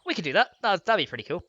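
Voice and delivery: usual speaking voice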